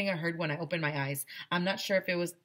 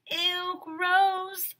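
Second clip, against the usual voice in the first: high, louder disgusted squeal; high grossed-out squeal; grossed-out kids; 6 dB louder than usual. A narrator's reading. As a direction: recoil like kids at something icky. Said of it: "we all said".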